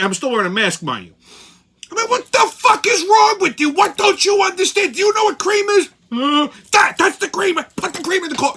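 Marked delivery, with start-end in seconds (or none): 1.9-8.5 much higher, loud furious yell. Retold or imitated. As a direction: rant at the clerk through the plexiglass. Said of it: "I yelled".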